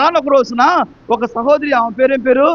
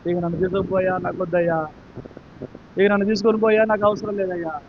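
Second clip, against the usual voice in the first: lower, much softer tearful murmur; soft weeping plea; 5 semitones lower, 8 dB quieter than usual. Retold or imitated. Imitating a weeping believer for the congregation, soft and tearful. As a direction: imitate someone crying quietly. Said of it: "ఏడ్చేవాళ్ళు".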